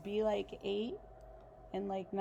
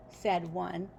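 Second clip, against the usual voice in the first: ordinary delivery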